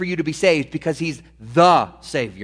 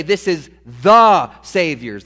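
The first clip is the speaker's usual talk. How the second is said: "the" leaned on, slow and louder; stress on THE; 3 semitones lower, 4 dB louder, slower than usual. Shown as a demonstration. Preacher to congregation, stressing "the" slowly. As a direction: press "the" as if spelled in capitals. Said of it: "That's capital T H E".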